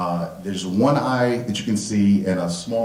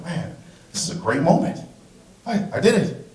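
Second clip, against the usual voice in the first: excited; 8 semitones higher than usual. Retold or imitated. Excited inner voice, proud moment.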